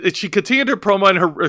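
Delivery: ordinary talk, nothing marked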